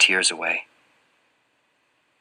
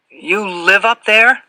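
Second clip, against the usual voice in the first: higher-pitched question; 12 semitones higher, 6 dB louder, slower than usual. A narrator's reading. Boy questions the fat man.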